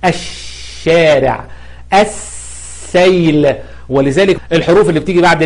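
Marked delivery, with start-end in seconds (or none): 0.1-1.4 الشارع slowly, with a long hissing sh; stressed shadda. Shown as a demonstration. Teacher showing shadda, الشارع with prolonged sh. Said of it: "شد على شين". 1.9-3.5 السيل with a very long held s, slowly; doubled sun letter. Shown as a demonstration. Teacher giving another example, السيل with prolonged s. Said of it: "الحرف اللي بعد اللام مشدد".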